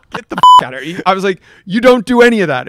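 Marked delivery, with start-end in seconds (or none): 0.1-1.0 disbelieving shout at Tiger Woods; mock outburst. 1.7-2.7 higher, louder incredulous echo; surprised. Retold or imitated. Retold shock at Tiger.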